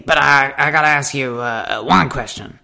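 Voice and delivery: slowing Texan voice, building up; Texan accent. Retold or imitated. Texan customer preparing to question his waitress, Texan accent. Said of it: "he said to me".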